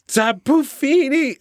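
high slow Pokemon cry; pull string toy. Pretend. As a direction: sing the name high and slow. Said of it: "I don't know what sound it makes".

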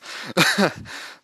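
short airy laugh; breathy laugh. Real, not acted. Laughs at losing her thought.

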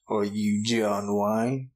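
slow Western drawl, dropping low; John Wayne. Acted. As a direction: drawl it like a movie cowboy. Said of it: "the John Wayne impression".

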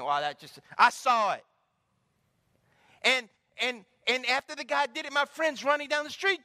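high, loud preacher's drive; full-force sermon delivery; fervent preaching. Real, not acted. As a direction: preach it with fire to the crowd.

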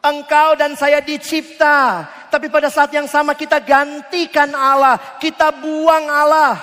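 loud, slow, driving sermon lines; forceful preaching. Acted. Preacher pressing the congregation about sin, forceful. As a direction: preach it hard to the crowd.

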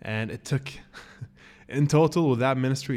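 usual speaking voice

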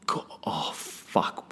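hushed, breathy panic under the breath; a frantic whispered curse; panicked whisper. Retold or imitated. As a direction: whisper it in horror so no one hears. Seeing the stain on the sheets.

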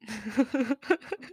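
amused laugh at own joke. Real, not acted. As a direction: laugh at your own quip.

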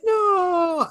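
very high, stretched-out mock wail; imitated little girl's high wail; crying child imitation. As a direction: squeal it like a protesting kid. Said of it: "and she's crying".

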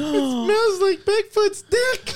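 goofy high voice quoting Anchorman; silly movie quote voice. Acted. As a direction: squeal the movie line in a goofy voice.

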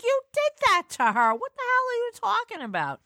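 high, indignant telling-off; indignant sister scolding; exasperated scolding. Retold or imitated. Scolding brother.

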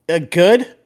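a hint of a driver brushing off a rookie question; curt driver. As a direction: shrug it off like a tired driver.

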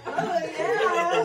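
high laughing "Oh, yeah". Real, not acted. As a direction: chuckle through it. [name] laughing with child.

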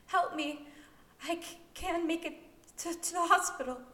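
high, loud, stammering plea; desperate begging. A narrator's reading. Dying woman begs to reach the hospital, high and stammering. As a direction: stumble over words in pain.

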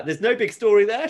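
quoting Buffy. Retold or imitated. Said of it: "she says".

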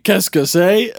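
mock French, lifted and bright; French accent. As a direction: play the lost foreigner in French. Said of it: "I was like".

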